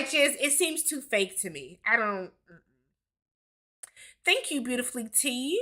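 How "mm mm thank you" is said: ordinary talk, nothing marked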